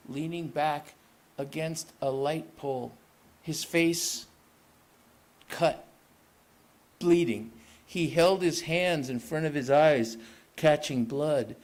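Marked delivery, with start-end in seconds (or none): none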